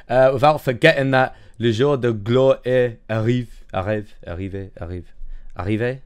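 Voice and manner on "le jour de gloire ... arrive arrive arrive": halting French in an English accent; clumsy, searching French pronunciation; bad French accent. Shown as a demonstration. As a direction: try the French slowly, repeating the last word. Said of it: "cringe at my terrible accent".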